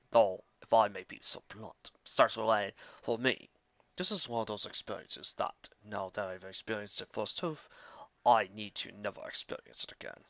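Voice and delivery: a hint of a weary scholar; dry old wizard. Star Swirl bluntly declining meat, low and dry.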